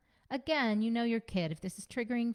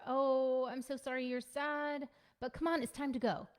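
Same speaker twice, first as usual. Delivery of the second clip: higher, sing-song hollow sympathy; fake sympathy; 6 semitones higher than usual. Parent brushing off a sad child, higher and hollowly sympathetic. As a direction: pat them off and hurry them along.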